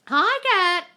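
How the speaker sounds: high, loud, happy hello; excited greeting. Real, not acted. Coach spotting a viewer in the live, excited greeting. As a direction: call out a delighted hello.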